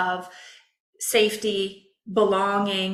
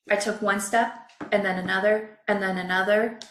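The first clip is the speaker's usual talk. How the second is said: usual speaking voice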